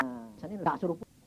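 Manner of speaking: ordinary delivery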